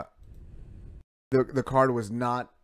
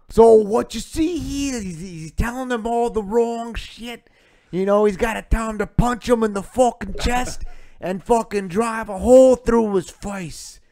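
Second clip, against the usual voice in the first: much higher, louder, slow mock corner yelling; hammed-up corner coach; 11 semitones higher, 7 dB louder, slower than usual. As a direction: rant like a wild cornerman feeding bad advice. Mocking a corner coach's advice.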